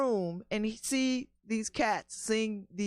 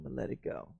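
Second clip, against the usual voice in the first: softer murmur, letting it fade; trailing off to himself; 10 semitones lower, 9 dB quieter, faster than usual. Real, not acted. A man calming himself over missing films, soft murmur. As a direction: talk yourself down quietly. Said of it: "repeating to calm himself".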